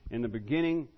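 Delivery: ordinary talk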